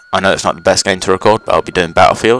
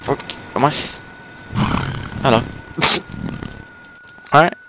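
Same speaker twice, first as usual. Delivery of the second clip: usual speaking voice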